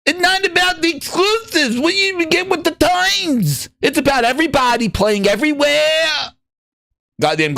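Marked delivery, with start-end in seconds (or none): 0.1-6.1 higher, sing-song mock fanboy. Streamer mocks Xbox fans' talk, high sing-song parody. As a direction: parody the gushing fans, pitched up. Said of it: "they talk like this".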